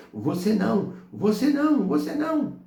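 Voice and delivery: retold demon shouting in mounting, high-pitched panic; demon's shriek. Demon recoiling as the humble elder walks in, shrieking. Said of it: "começou a gritar dizendo".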